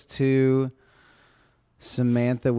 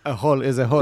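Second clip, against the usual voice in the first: simple brute, a touch louder; lightly voiced caveman; 3 semitones higher, 5 dB louder, faster than usual. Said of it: "your caveman voice is the same as a taxi".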